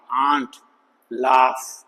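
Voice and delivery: "आंट, लाफ" with a long, low AA vowel; long AA sound. Shown as a demonstration. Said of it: "आ की".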